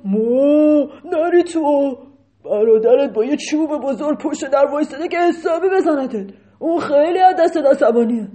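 loud cow voice starting with a moo; moo, then warn him loudly; lowing cow. Acted. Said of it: "یه گاو دیگه گفت".

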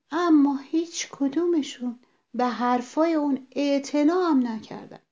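ordinary delivery, nothing marked

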